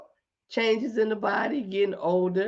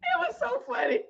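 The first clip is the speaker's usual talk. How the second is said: high voice cracking with laughter; laughing; 4 dB quieter, faster than usual. Real, not acted. Recovering from the story, laughing.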